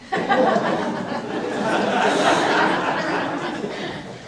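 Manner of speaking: high airy burst of laughter; real giggle, high and airy; breathless laugh. Real, not acted. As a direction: laugh out at your own joke. Laughs after mocking parents.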